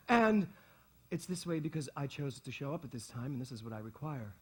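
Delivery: normal talking voice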